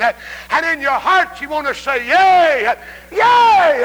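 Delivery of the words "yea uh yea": loud, high climactic YEA; exultant shout. Acted. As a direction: shout it from an overwhelmed heart. Climax, heart wants to say yea.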